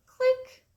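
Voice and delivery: loud high "click"; camera-click voice. A narrator's reading. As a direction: snap it like a camera. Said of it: "She blinked her eyes and said".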